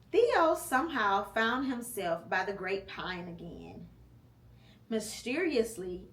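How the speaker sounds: ordinary delivery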